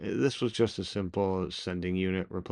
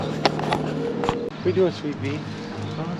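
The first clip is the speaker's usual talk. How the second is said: talking to a dog; 7 semitones higher than usual. Real, not acted. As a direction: coo gently to the puppy. Cooing softly to dog.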